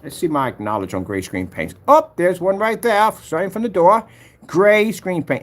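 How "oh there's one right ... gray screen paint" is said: loud, high put-on English accent. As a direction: call it out slowly in a mock English accent. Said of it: "you think they're making fun of your accent".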